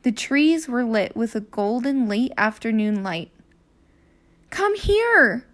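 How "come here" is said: high, eager call. Acted. Annie calls Jack over to the oak tree, high and eager. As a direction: beckon him over. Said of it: "called Annie".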